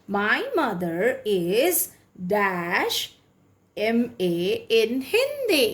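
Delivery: very slow, word by word; slow dictation. Shown as a demonstration. Teacher dictating an example sentence to students, very slowly. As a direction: spell it out for them to write down.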